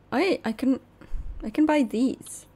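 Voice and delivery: ordinary talk, nothing marked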